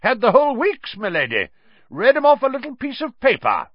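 high, loud boasting; proud. A narrator's reading. Portrait knight tells the professor of the passwords, proudly. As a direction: crow it out with pride. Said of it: "said Sir Cadogan proudly".

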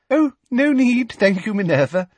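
simpering. A narrator's reading. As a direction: coo it with false sweetness. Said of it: "simpered Professor Umbridge".

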